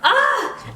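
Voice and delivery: a high, loud, delighted shriek; thrilled squealing laugh. Real, not acted. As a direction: burst into a giddy squeal. Squeal laugh after repeat.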